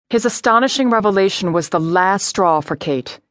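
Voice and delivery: plain reading voice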